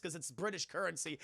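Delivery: ordinary talk, nothing marked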